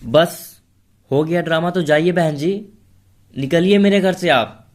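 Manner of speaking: high, loud furious outburst; angry. Acted. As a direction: throw her out of the house, shouting. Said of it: "Usha जी गुस्से से बोली".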